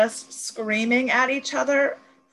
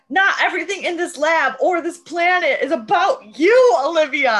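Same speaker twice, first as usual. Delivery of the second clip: higher and louder retold yell; mock shouting; 7 semitones higher, 6 dB louder than usual. Price shouting at Olivia in the lab, loud. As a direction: shout it at her like a fed-up husband. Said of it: "And he's like".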